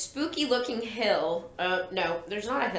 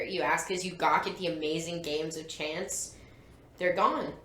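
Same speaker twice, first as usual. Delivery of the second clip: story narration; 2 semitones lower, 6 dB quieter than usual. A narrator's reading. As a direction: narrate the fairground scene, voice lowered. Reads the book aloud.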